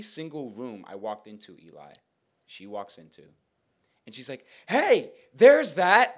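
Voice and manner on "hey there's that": loud, high, drawn-out holler; mock calling out. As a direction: holler it slowly across a room. Woman calls out across room.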